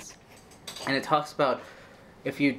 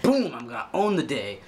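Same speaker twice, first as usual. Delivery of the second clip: pumped up. Retold or imitated. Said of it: "I'm like".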